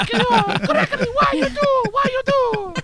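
high, drawn-out squeal broken by laughter; laughing mock whine. Teasing a friend about his mom, high laughing squeal. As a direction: squeak it out slowly, cracking up. Mocking mother through laughter.

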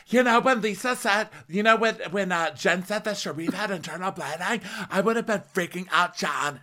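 hammed-up worried wife; mock Lisa to John. Host imitating Lisa calling her husband, high and dramatic.

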